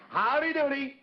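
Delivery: high, bright puppet voice calling out; Howdy's greeting. Acted. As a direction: sing out the greeting high and bouncy. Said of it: "Let me hear that again".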